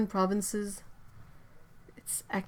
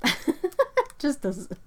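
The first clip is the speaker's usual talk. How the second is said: laughing; 7 semitones higher, 3 dB louder, much slower than usual. Real, not acted.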